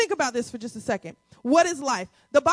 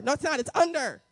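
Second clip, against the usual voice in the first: ordinary talk, nothing marked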